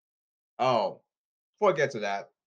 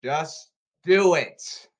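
very slow, louder slogan; slow emphatic slogan; 6 dB louder, much slower than usual. Real, not acted. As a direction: drag out each word for emphasis.

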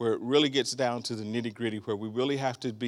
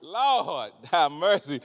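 ordinary talk, nothing marked

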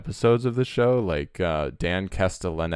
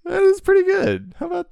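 high-pitched excited; 7 dB louder than usual. Acted. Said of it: "mocking pitch after impression".

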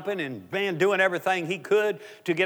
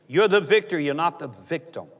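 normal talking voice

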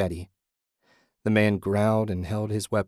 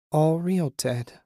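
higher, lightly voiced woman; a hint of a mysterious female voice; female apparition; 7 semitones higher, slower than usual. A narrator's reading. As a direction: answer as a ghostly woman, pitched up. Female apparition answers him.

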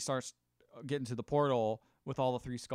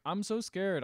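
mildly anxious, raised voice; scared Blue Falcon; 7 semitones higher, slower than usual. Retold or imitated. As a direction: imitate a frightened hero, lightly. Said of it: "who's having an existential crisis".

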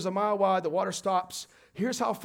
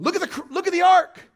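higher, louder call to look at the ark; 10 semitones higher, 9 dB louder than usual. Retold or imitated. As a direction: urge the crowd forward. People encouraging crowd.

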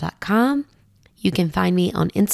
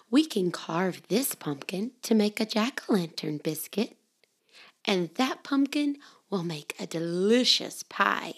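ordinary delivery, nothing marked

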